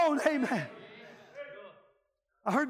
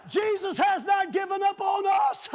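raised, pleading revival preaching; fervent altar-call preaching; preaching at full force; 5 semitones higher than usual. Acted. Preaching climax.

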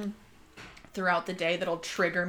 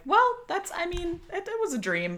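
mock casual shrug; 11 semitones higher than usual. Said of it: "I'm like".

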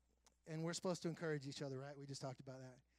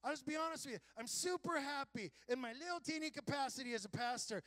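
raised, fervent preaching voice; 7 semitones higher, 3 dB louder than usual. Acted. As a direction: preach it with conviction. Preaching at full force.

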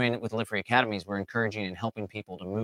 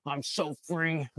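mock pissed-off defiance; 3 semitones higher than usual. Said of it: "you're all pissed off".

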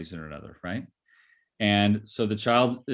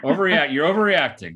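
mock dismissive partner; 7 semitones higher, 7 dB louder than usual.

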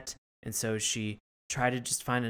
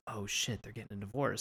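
put-on softer, hushed inner panic of a kid; kid's worried thought; 3 semitones lower, 8 dB quieter than usual. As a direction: mutter it like a scared kid thinking. Kid panicking at parents' fight.

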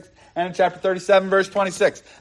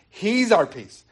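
normal talking voice

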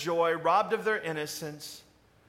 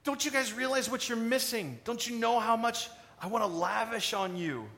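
pleading master; 4 semitones higher than usual. Acted. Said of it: "He's like".

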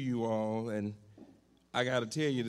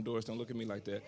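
fast teasing aside; 6 dB quieter, much faster than usual. Real, not acted. Quick teasing aside to wife.

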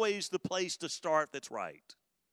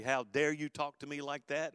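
ordinary talk

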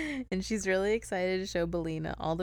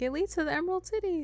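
put-on high fast girl; 12 semitones higher, faster than usual. Dorothy voiced in story.

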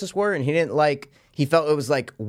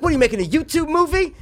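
high mock shouted quote; squeaky hammed-up gangster line; imitating Big Dave; 14 semitones higher, 4 dB louder than usual. Friend retelling the van scene, high mocking voice. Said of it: "He's like".

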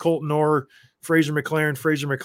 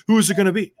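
higher, louder mock challenge; retold fired-up demand; 6 semitones higher, 5 dB louder, much faster than usual. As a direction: replay an impatient demand at the rival bench. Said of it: "And I'm fired up".

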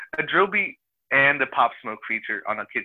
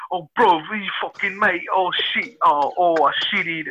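mock British accent; 5 semitones higher, slower than usual. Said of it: "who are just like".

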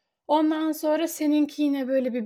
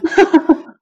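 breathless laugh; 4 semitones higher, 11 dB louder than usual. Real, not acted. Laughing after running upstairs.

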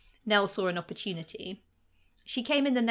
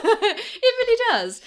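laughing; 13 semitones higher, 9 dB louder, slower than usual. Real, not acted. Laughing reply to co-host.

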